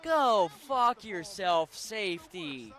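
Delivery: loud, very drawn-out curse; cursing out. Real, not acted. Streamer cursing the safety in game, drawn-out loud curse. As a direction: stretch each word out loudly, easing off at the end.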